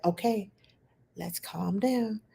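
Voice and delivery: soft, slowed calming voice; soothing. Retold or imitated. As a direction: settle an upset toddler gently. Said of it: "and I'm like".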